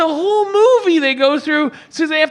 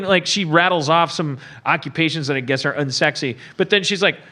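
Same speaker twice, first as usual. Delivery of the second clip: ordinary delivery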